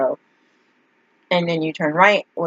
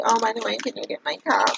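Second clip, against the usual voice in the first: higher-pitched imitation of him asking; mock casual request; 7 semitones higher, 3 dB quieter than usual. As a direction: put on a light, high asking voice. Acting out the guy's casual request.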